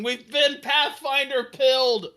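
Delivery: high, loud and slow, hammed-up player's yell; mock scream. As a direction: stretch it out like a player crying foul in mock horror. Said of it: "my player started screaming".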